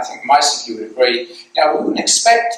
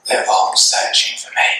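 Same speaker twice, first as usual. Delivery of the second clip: put-on secretive small-kid whisper; hushed, breath-only kiddie voice; little child whispering. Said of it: "And the little voice said".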